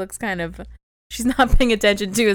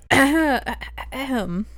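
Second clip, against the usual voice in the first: ordinary delivery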